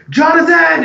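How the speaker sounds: loud, high call upstairs; frightened old man shouting for Jonathan; yelling a name. Acted. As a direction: yell up for Jonathan. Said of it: "he starts yelling up to Jonathan".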